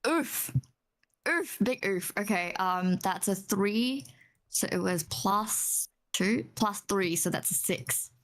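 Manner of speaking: ordinary talk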